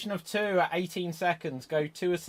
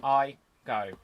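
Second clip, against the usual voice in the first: ordinary talk, nothing marked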